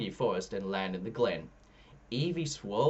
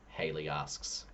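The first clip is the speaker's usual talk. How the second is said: ordinary delivery, nothing marked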